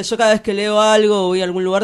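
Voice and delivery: ordinary delivery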